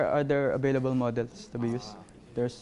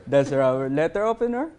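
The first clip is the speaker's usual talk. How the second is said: ordinary delivery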